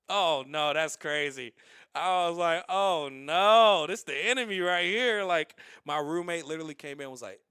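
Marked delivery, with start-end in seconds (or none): 0.1-5.2 loud mock-panicked "Oh, no"; alarmed shout. He panics about the late-night caller, shouting. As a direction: yell it like the enemy arrived.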